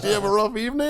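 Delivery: mock concerned MP. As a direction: needle him sweetly. MP teases Danny.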